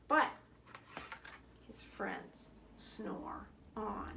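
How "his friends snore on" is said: slow hushed whisper. A narrator's reading. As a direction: whisper it so the sleepers don't wake. Bedtime ending, friends asleep.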